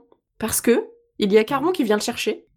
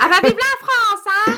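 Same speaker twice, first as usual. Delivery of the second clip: mock patriotic; 11 semitones higher, 3 dB louder than usual. Mocking patriotic exclamation.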